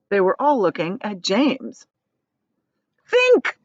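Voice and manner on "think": high, loud urgent plea; begging. Acted. Miss Spider begging James, high urgent plea. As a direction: beg him hard. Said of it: "begged Miss Spider".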